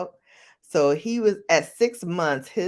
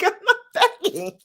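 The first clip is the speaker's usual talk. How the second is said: laughing protest; 5 dB louder, much faster than usual. Retold or imitated.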